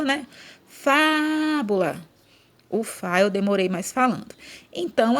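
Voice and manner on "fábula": FÁBULA called high with the FÁ stretched long; calling out the word. Shown as a demonstration. Teacher calls the word like a person, drawn-out calling on FÁ. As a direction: call FÁBULA, holding FÁ and falling on BULA. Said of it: "chamar também como se fosse uma pessoa".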